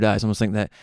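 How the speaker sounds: ordinary talk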